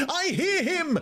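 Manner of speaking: very high-pitched outcry; ecstatic cry. A narrator's reading. Woman springing to her feet at the approaching being, crying out. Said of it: "she cried".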